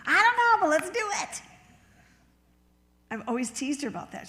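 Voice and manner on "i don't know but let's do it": high, loud, gung-ho outburst; imitated hyper excitement. Sister-in-law eager to go anywhere, imitated excited yell. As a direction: burst out far above normal pitch. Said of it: "She's always in hyper gear".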